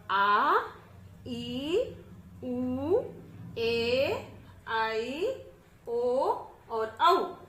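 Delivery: each long vowel held out clearly and loud; teacher drilling आ ई उ ए आई ओ औ; long vowels, demonstrated. Shown as a demonstration. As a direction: model the vowels slowly for the class to copy. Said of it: "देखिए, आपको भी इसी तरह से करना है".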